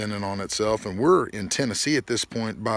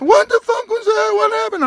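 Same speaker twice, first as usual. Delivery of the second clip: much higher, loud startled yell; startled shout; 9 dB louder than usual. Retold or imitated. Car owner waking up startled, shouting. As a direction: wake up in shock. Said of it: "he shoots up".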